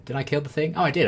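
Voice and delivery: sudden delight at the kill; surprised. Real, not acted.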